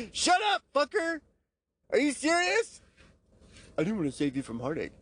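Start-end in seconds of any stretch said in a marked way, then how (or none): 0.1-2.8 very high, loud yell of disbelief; high, outraged yell; incredulous shout. Real, not acted. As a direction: shout at yourself, can't believe it. Yelling at his past self.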